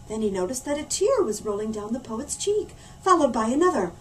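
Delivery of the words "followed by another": ordinary delivery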